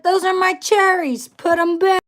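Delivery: high, loud and indignant; playfully outraged yell to dad; mock indignant shout. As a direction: snap it like a kid defending her snack. Retelling her own protest to dad.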